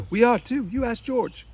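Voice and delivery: put-on Lenny pushing back like a stubborn kid; childlike Lenny. Lenny insists to Crooks the rabbits are real, high and childlike.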